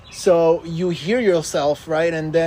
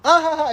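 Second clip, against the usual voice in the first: high, loud forced laugh at his own joke; fake loud laugh; 12 semitones higher, 6 dB louder, faster than usual. Prankster laughing at his own pointless joke, high and loud. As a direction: cackle loudly at your own bad joke. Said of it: "and he starts laughing".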